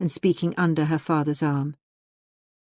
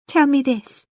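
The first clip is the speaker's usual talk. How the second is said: higher, louder pleading; importunate plea; 8 semitones higher, 5 dB louder than usual. A narrator's reading. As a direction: press for an answer, mildly anxious. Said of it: "she importuned with a trembling mouth".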